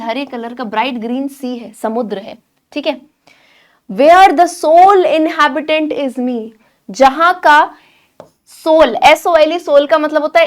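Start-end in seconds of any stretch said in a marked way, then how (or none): 3.9-6.5 dreamy poem reading. Acted. Reads the poem lines.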